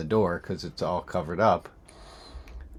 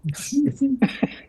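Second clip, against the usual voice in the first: much higher mock girlish laugh; high giggle; 5 dB louder than usual. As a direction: giggle like the daughter. Daughter laughing in quote.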